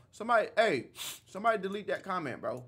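loud, fast call to the mods; shouting at chat. Real, not acted. Streamer yells at his mods to delete a comment, loudly. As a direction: yell for someone to delete it.

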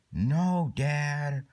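high, drawn-out protest; defiant child. Pretend. Kid refusing Dad's order, defiant. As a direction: stretch out a kid's whiny refusal.

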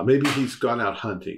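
normal talking voice

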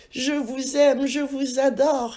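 bien plus aigu et plus fort; s'emballer d'amour pour la star; déclaration exaltée. Acted. Le putois déclare son amour. (le putois enlacé à Lapin, voix aiguë et exaltée)

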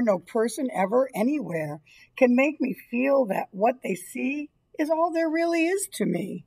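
normal talking voice

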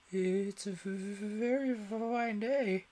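slow, nervous stuttering; timid rabbit stammering beside Alice. A narrator's reading. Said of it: "said a timid voice".

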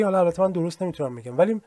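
ordinary delivery

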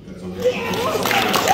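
long, very high, loud call; drawn-out Godzilla. Acted. Actor hyping the crowd with the film's title, drawn-out call. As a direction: stretch it out like a battle cry.

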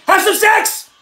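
comic yelling answer to a letter; excited yell. Acted. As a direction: shout the advice like a party host. Mock advice column answer, yelling.

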